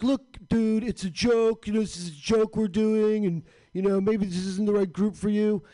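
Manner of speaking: high, loud whiny mock comedian voice; a condescending nerd talking down. Mocking the comedians who told him to leave. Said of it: "And they're like".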